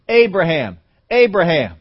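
loud ringing call of a name. Acted. God calling out to Abraham loudly. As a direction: call his name across a distance.

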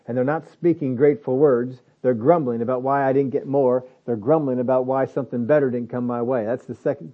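ordinary talk

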